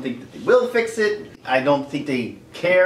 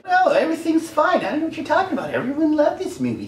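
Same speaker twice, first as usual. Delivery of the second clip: much higher breezy fake-innocent voice; mock denial; 10 semitones higher than usual. Studio pretending to fans, high breezy denial. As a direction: brush it off sweetly, pretending all is fine. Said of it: "be like".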